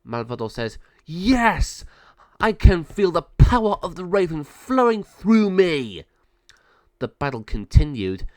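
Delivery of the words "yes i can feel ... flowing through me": high, loud raven-witch triumph; gloating villain. Acted. Raven villain gloats over her transformation, loud triumphant cry. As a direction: crow over your new power. Villain exults in new power.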